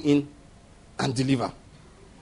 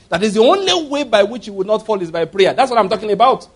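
normal talking voice

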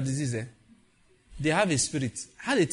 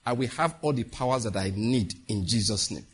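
prayer declaration; 4 semitones lower than usual. Shown as a demonstration.

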